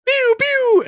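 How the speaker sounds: very high pew pew noise; imitated firework. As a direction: mimic rockets shooting up. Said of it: "So I'm in the front yard".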